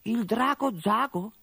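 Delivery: high, slow astonished question; mother caught off guard by the name; surprised mother. Acted. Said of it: "si stupì la madre di Gorgo".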